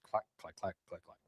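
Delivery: very quiet, low clacking, fading away; imitated swinging doors. Teller showing the doors left swinging, hushed clacking. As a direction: mimic doors swinging to a stop, softly.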